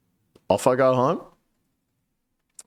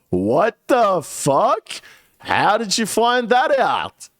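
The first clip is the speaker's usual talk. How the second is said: slow, high and loud stunned outburst; shocked disbelief; 11 semitones higher, 3 dB louder, slower than usual. Retold or imitated. To the boss on the phone, stunned loud disbelief. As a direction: drag out the shock, pitch soaring. Boss reveals she knows.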